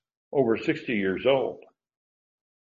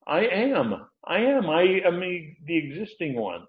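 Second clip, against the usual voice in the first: exalted I am; 7 semitones higher than usual. Acted. Preacher voicing God's name.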